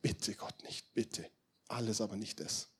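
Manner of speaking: soft, nearly voiceless plea; hushed pleading. Acted. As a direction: beg God under your breath. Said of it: "Er hat gefleht". (leises, flehendes Bitten)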